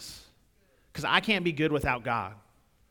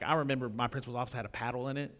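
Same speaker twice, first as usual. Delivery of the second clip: normal talking voice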